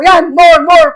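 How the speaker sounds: loud, high egging on; urging on. Retold or imitated. Prophetess urging him on.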